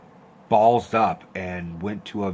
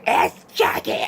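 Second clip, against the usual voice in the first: frozen whisper; 9 semitones higher, 4 dB louder, slower than usual. Retold or imitated. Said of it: "I was just frozen, and I was just like".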